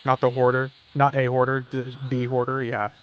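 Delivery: ordinary talk, nothing marked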